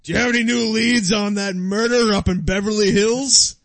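angry chief. Pretend. Police chief demanding news on the murder, loud shout. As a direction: boss yelling at his detective. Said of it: "This is my chief".

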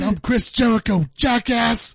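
high, loud arrogant wrestler voice; Jericho impression. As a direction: sneer it out at full volume.

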